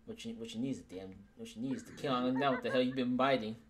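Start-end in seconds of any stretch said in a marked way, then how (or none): none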